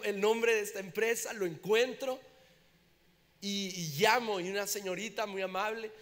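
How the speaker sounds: ordinary delivery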